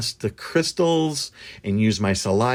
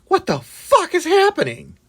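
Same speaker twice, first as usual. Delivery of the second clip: mock alarmed what-the-hell; shocked disbelief; 4 dB louder than usual. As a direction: squeal it like you can't believe it. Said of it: "And I was just like".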